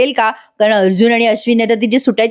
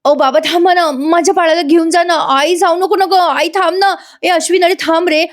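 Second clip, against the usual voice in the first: higher pleading calls in sleep; dream shouting; 7 semitones higher than usual. Acted. Priya begs her family to stay in her nightmare, pleading. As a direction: beg them to stop, lost in a nightmare. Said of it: "एवढ्या मोठ्या मोठ्याने आरडाओरडा करत असते झोपेत".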